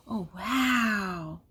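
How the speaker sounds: long, drawn-out falling wow; playful amazement. Pretend. Reader reacting to the ghost reveal, awed. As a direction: play up the wonder for small children.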